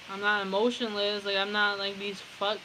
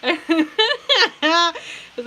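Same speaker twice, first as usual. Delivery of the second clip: laughing while she talks; words squeezed out through laughter; 8 semitones higher, 12 dB louder than usual. Real, not acted. Laughing while retelling her ex's reply.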